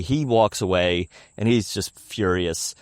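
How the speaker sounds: usual speaking voice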